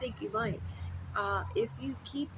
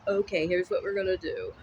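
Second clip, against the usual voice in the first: bossy commander; 8 dB louder than usual. Retold or imitated. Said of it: "you're probably like".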